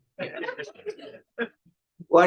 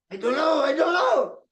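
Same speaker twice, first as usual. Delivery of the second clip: mock crying; 13 semitones higher than usual. Crying devotee answers a mourner, high mock wailing. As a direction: wail the words out, stretching the ends. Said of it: "He started to cry more louder".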